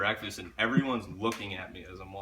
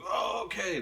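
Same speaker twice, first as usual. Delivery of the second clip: high-pitched mock-meek voice; meek compliance; 9 semitones higher than usual. Drunk guest answering the angry mom, mock-meek. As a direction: give in meekly, pitched up.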